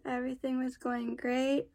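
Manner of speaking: usual speaking voice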